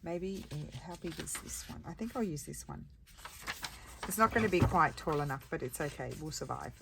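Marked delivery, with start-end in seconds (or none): none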